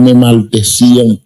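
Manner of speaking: normal talking voice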